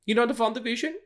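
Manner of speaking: high put-on German accent on "You know the WandaVision?". Joking with his co-host in a German accent. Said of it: "I was just doing a German accent".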